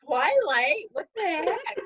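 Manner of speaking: high, loud mock disbelief; mock incredulous. Mother mimics her own disbelief at her husband, high and loud. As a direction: scoff in exaggerated disbelief.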